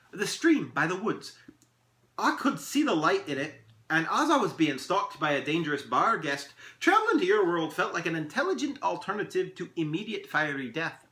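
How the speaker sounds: high, animated elf voice; lilting, eager elf tale; storytelling elf. Acted. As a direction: recount the escape with theatrical flair. Elf tells of his escape.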